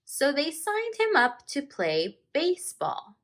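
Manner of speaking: slow, expressive read-aloud with long page-turn pauses; story narration. A narrator's reading. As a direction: read it like a teacher sharing a picture book with the class. Teacher reads picture book aloud.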